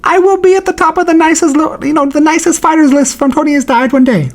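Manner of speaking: very high-pitched fighter impression; hammed-up squeaky vow to the fans; Brandon Moreno. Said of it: "Listen, Brandon Moreno".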